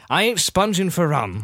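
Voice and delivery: mannered pirate captain; Jack Sparrow. Acted. Jack answers the other pirate, swaggering pirate voice. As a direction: play the slippery film pirate. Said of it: "Jack Sparrow".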